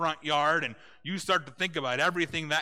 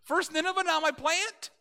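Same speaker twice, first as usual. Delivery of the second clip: high, loud exasperated protest; indignant rant; 6 dB louder than usual. Retold or imitated. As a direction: whine at God like a spoiled child. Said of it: "He's like".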